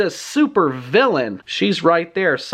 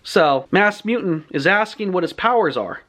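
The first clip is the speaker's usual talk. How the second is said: ordinary delivery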